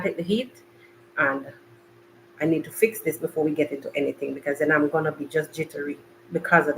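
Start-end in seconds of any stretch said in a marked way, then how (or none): none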